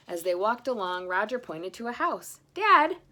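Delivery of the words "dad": high, loud call to dad; excited child. Acted. Roger calling out to his dad, high and loud.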